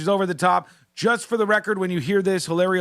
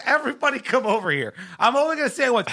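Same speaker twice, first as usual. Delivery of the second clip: higher, put-on voice summoning a crowd; mocking impression of her; 6 semitones higher, faster than usual. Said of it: "she says".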